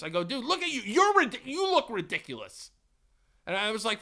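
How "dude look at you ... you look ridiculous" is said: higher, heated comeback; firing back an insult in a raised voice; mock angry retort. Shopper snapping back at the clerk, heated and higher. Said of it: "I fired off on this dude".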